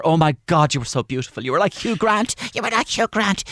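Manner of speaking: high-pitched doting granny impression; high, adoring mimicked grandmother; gushing grandmother. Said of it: "it was my grandmother".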